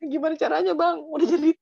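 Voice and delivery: high, laughing protest; giggly incredulous comeback; laughing retort. Acted. Laughing retort in acted breakup.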